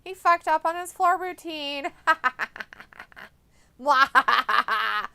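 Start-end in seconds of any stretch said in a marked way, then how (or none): 2.0-5.1 laughing hard at a fail; real loud laughter at a botched landing. Real, not acted. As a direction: burst out laughing.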